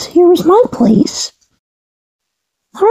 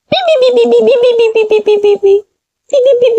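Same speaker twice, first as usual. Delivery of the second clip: high robotic beeping; 5 semitones higher, much faster than usual. Pretend. Between play lines, high imitated beeping. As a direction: imitate a machine's stream of beeps.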